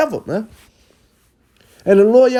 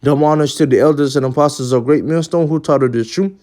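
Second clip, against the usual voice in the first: lower, formal benediction voice; closing blessing; 6 semitones lower than usual. Acted. Preacher sending off listeners with a low, formal blessing. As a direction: intone the blessing to close the lesson.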